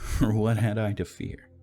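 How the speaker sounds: high, loud gleeful laugh. Acted. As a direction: cackle the question with glee. Mad narrator, loud laugh.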